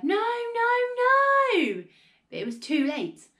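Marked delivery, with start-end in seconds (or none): 0.0-1.8 very high, loud drawn-out "no"; frantic, stretched-out shouts of protest; horrified cry. A narrator's reading. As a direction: cry out in rising desperation. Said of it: "the other two cried".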